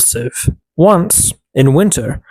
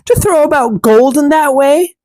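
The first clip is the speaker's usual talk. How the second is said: jealous stepsister; 11 semitones higher than usual. A narrator's reading. Stepsister jeering at the girl, higher and sneering. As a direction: spit it out high and scornful. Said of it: "cried the stepsister".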